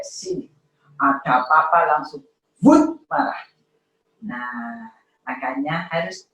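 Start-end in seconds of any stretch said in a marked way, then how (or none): 2.7-3.4 sudden anger burst. Retold or imitated. Imitating instant anger.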